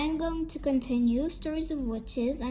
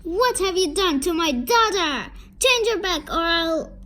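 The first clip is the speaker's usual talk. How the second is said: loud, raised demand; angry yelling; 5 semitones higher, 7 dB louder than usual. A narrator's reading. Father demands the witch undo her spell, yelling. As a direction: shout it as an angry threat. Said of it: "he yelled".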